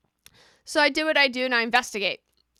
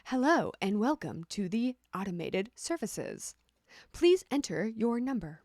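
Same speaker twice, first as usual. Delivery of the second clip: even, softer phone-menu voice; automated voice; 3 dB quieter than usual. Shown as a demonstration. Showing viewers her voice for automated phone lines. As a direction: sound like a recorded phone system greeting. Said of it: "I use my automated voice".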